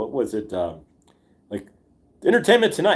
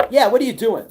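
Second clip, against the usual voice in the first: put-on high, loud scolding girlfriend; 11 semitones higher, 6 dB louder than usual. Girlfriend scolding her boyfriend who is yelling, high and loud. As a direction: snap at him like his girlfriend would. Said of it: "you get a good".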